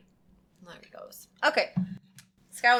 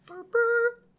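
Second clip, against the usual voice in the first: mock burp. Mock burp during level check.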